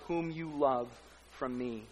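ordinary talk, nothing marked

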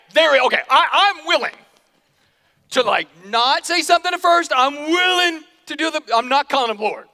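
loud, high, stubborn protest; reluctant wife. Acted. Preacher voices a wife refusing, loud and high. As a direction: dig in against calling him Lord.

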